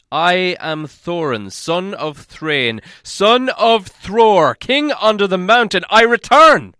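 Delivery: very slow, high, booming proclamation; loud cry. A narrator's reading. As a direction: thunder out the royal return. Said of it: "cried Thorin in a loud voice".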